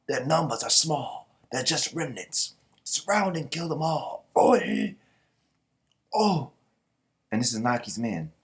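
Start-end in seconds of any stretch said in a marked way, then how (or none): 0.1-6.5 raised, louder orders rising to war cries; rally troops to surround the enemy; battle command. Acted.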